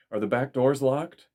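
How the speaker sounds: sharp, loud and urgent; urgent fear. A narrator's reading. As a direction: demand it fast, danger is close. Intruder in the house.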